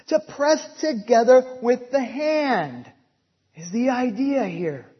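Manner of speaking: loud, emphatic preaching, leaning on every word; full-force preaching. Acted. Preaches word definition loudly.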